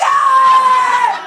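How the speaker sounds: high, loud, drawn-out scream; loud stretched "aah!"; shocked scream. Acted. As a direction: let out a big high scream. Acting out a shocked reaction.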